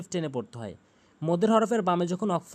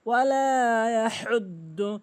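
higher, drawn-out chanted model; Quran recitation; 3 semitones higher, slower than usual. Shown as a demonstration. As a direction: recite with long vowels and throaty "h". Recites the phrase. (টেনে তিলাওয়াত)

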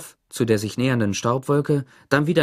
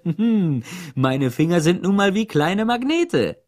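laughing; 7 semitones higher, 4 dB louder, slower than usual. A narrator's reading. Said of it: "und lachte".

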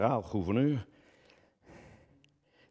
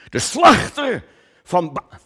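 declaiming shout; 10 semitones higher, 11 dB louder, slower than usual. Acted. Declaiming the epithet, breaks off.

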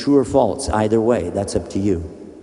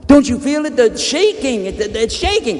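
urgent pleading; 12 semitones higher, 4 dB louder than usual. Acted. Urging him as ground shakes.

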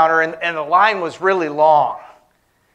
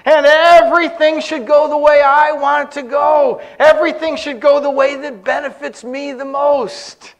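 high, loud-ish mock entitlement; hammed-up self-importance; self-centered declaration; 7 semitones higher, 4 dB louder than usual. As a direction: proclaim it like the center of the universe. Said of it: "a way of thinking and living that says".

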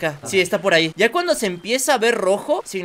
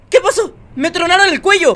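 startled alarm; 8 semitones higher, 6 dB louder than usual. Real, not acted. Just got killed in game.

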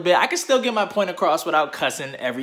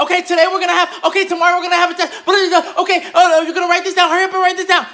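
much higher, rushed mock teacher gabbling; Roadrunner teacher voice; 11 semitones higher, 4 dB louder, faster than usual. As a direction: parody a teacher racing through announcements. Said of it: "their freaking fucking Roadrunner voices".

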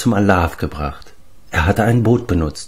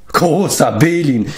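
slow, raised-pitch cry; exasperated exclamation; 6 semitones higher than usual. A narrator's reading. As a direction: exclaim it, stretching the words. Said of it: "rief Leftur".

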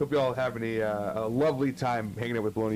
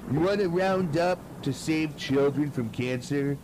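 lightly put-on cashier asking for a donation; mock cashier; 5 semitones higher than usual. Cashier asking shopper to round up at the grocery line, mock cashier. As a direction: pitch it up a bit, imitating a checkout request.

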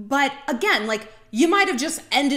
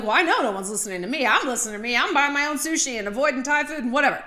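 quick, sassy mock retort; lightly acted defiance, rushed; defiant self-talk; 2 semitones higher, faster than usual. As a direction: toss it off like a self-sufficient single. Voices a defiant single person.

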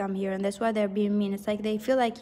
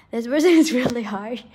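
real laugh breaking through, louder; amused laughing; 6 dB louder than usual. Real, not acted. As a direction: laugh your way through the words. Amused by the harsh teasing.

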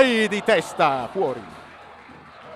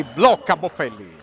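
usual speaking voice

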